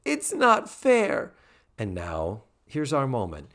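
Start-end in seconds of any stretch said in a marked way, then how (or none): 0.0-1.3 much higher, slow mock whine; whiny teen. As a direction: drag out a sulky teen complaint. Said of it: "Tootie says".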